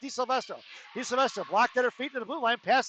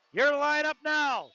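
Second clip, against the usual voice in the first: slow, drawn-out PA announcer call; arena announcer call; 3 semitones higher, slower than usual. Real, not acted. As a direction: stretch each word like an arena announcement. Announcing the new line on ice.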